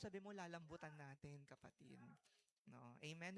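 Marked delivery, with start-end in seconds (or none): 0.3-2.0 soft, tender coaxing to a brother; a softly loving appeal to a hard-hearted brother; with love. Shown as a demonstration. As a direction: coax it gently, almost hushed. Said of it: "With love dapat".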